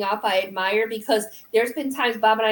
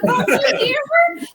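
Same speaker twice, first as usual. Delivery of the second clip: much higher, squealing mom did you hear her; excited disbelief; 3 dB louder than usual. Retold or imitated. Daughter calling to her mom, high and thrilled. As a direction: squeal it in shocked delight. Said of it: "I said".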